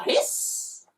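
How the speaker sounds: breathy unvoiced hiss; a cat's unvoiced hiss. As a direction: hiss like an angry cat. Cats hiss.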